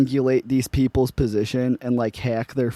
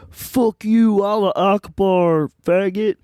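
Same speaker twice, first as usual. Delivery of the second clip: higher-pitched, drawn-out jeer; mock outburst; 7 semitones higher, slower than usual. Prank-call victim taunting a scammer, mock outburst. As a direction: throw it out as a mocking taunt.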